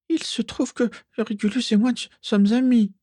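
put-on very high, small girl's voice; insistent squeak. A narrator's reading. Mimi claiming Régulus as her friend, insisting squeakily. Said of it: "affirma encore Mimi".

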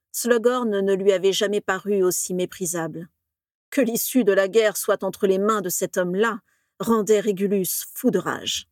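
usual speaking voice

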